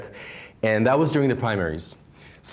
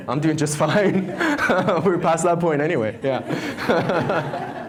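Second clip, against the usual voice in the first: laughing; 7 semitones higher, slower than usual. Real, not acted. Laughing at the interruption.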